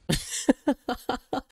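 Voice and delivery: high staccato cackle; mocking, high-pitched laughter; taunting laugh. Real, not acted. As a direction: laugh off the threat. Laughs at threat to call staff.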